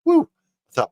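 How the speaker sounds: ordinary talk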